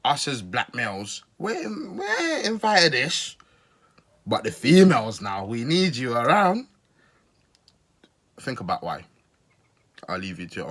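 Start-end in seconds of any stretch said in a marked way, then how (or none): 1.4-6.7 drawn-out mimicry, swinging high; slow mock-preacher emphasis; mocking imitation. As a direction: mimic his speaking with slow, exaggerated stretches. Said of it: "if you notice how he speaks".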